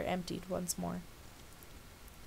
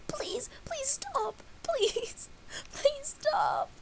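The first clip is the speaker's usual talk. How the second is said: high, tearful begging; crying and begging; much slower than usual. A narrator's reading. Dash begging Pinkie to stop, sobbing. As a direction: beg through sobs. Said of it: "Dash burst out crying and begging".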